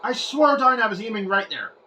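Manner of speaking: real disbelief at the miss; exasperated complaint. Real, not acted. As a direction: argue with the game. Shot goes wrong way.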